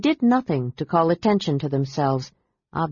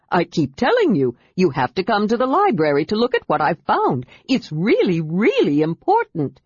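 eager kender; 3 semitones higher than usual. A narrator's reading. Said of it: "Tasselhoff cried".

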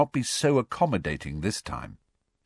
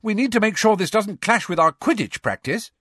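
high, louder player pressing her point; insistent Angelina; 12 semitones higher, 5 dB louder than usual. Acted. Team player objecting about Quidditch practice, high and insistent. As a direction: voice a concerned teammate, pitched well up.

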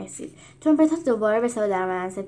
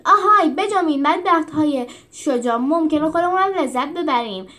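loud, higher rallying call; loud rallying shout to teammate; fired up; 5 semitones higher, 4 dB louder than usual. A narrator's reading. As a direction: rouse the team. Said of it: "روس عصبانی شد".

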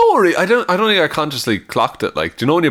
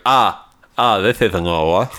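mock struggle; 5 semitones lower than usual. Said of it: "That's a very good rendition".

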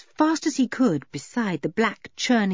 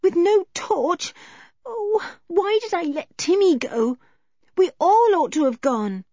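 put-on high, distressed child's voice; high-pitched, anxious self-reproach; panicky; 11 semitones higher than usual. A narrator's reading. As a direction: fret in mounting panic. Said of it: "began to be very panicky".

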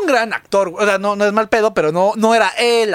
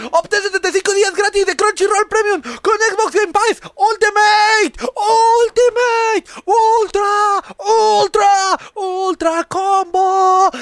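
high shouted arcade combo call; fighting game announcer; 11 semitones higher, slower than usual. Acted. (anunciador de videojuego de peleas)

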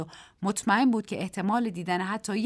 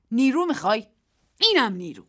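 louder, biting; angry retort; 5 semitones higher, 7 dB louder than usual. A narrator's reading. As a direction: hit back with scorn. After slapping her. (با خشم و طعنه)

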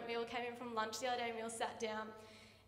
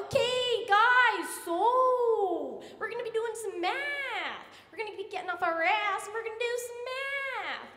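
much higher, loud and chirpy; peppy little teacher; 10 semitones higher, 11 dB louder, slower than usual. Retold or imitated. Canadian teacher rousing sleepy class, high and loud. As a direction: pump the class up at dawn.

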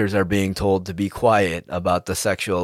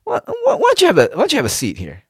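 Chris Hansen; 9 semitones higher, faster than usual. Retold or imitated. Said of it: "if I was Chris Hansen".

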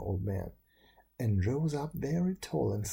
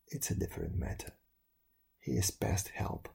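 quick, unvoiced whisper; hushed grim news; 4 semitones lower, 4 dB quieter than usual. A narrator's reading. Countryman whispering that the old man is past help. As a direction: lower your voice for the dying.

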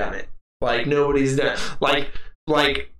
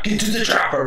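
higher, punchier action-hero bark; Arnold-style shout; 7 semitones higher, faster than usual. Retold or imitated. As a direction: bark it like a movie tough guy. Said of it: "And not".

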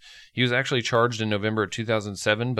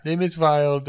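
louder put-on voice; lightly voiced sarcastic official; mock official excuse; 5 semitones higher, 6 dB louder than usual. Mocking the Russian excuse to his cohost, put-on voice.

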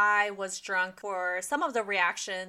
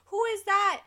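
amazed; 10 semitones higher, slower than usual. Retold or imitated. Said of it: "You're gonna be like".

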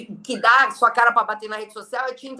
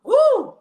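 "uh" bem agudo e forte; gritinho agudo; 13 semitones higher, 7 dB louder than usual. Acted. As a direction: solte um gritinho de impulso. Grito de energia antes da cobrança. (gritinho agudo de impulso)

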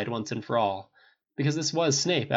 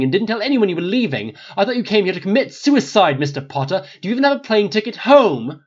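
higher, building angry tirade; rising interrogation, building to a raised-voice demand; shouting; 7 semitones higher, 9 dB louder than usual. A narrator's reading. Snape shouting in Harry's ear, angry and accusing. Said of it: "as he shouted in his ears".

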